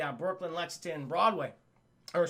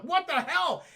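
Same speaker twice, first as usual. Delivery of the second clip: mock shock; 9 semitones higher, 4 dB louder, slower than usual. Gargoyles shocked, retold.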